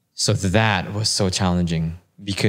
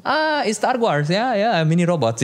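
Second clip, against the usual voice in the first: much higher put-on voice for the Puerto Rican driver; imitating Victor the driver; 12 semitones higher than usual. Driver greeting the arriving guest, high put-on voice. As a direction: play the friendly host asking a guest a question. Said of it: "so it turns out he's Puerto Rican".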